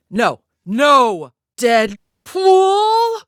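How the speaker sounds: loud, high cries building to a long scream; rising yell, last word stretched very high; escalating protest. Acted. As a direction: cry the name out in outrage. Crying out in protest at Deadpool.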